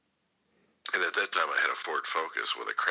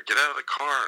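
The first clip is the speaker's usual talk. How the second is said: higher and louder, a cop's command; barked cop order; 11 semitones higher, 7 dB louder than usual. Retold or imitated. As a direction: bark it like a cop with a gun drawn.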